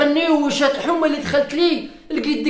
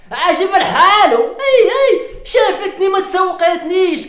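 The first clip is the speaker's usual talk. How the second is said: hammed-up bitter yell; bitter mock shout; 6 semitones higher, slower than usual. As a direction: cry out in bitter half-laughing protest. Bitter about her wedding.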